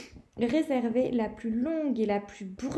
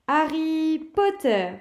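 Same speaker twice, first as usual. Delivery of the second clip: slow, louder name called out; teacher announcing the famous name; calling a name; 5 semitones higher, 7 dB louder, slower than usual. Acted. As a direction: summon him with weight. McGonagall calls the last name.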